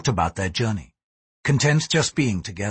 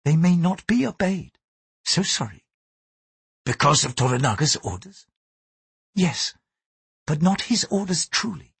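Mariko, gentle Japanese lady; 6 semitones higher than usual. A narrator's reading. Mariko warns her lover why he is guarded, higher, earnest. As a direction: speak up in a high, earnest woman's register.

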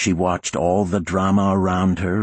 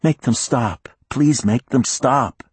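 pleading through tears; 8 semitones higher, 4 dB louder than usual. Acted. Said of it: "She was still crying".

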